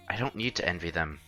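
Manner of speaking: ordinary talk, nothing marked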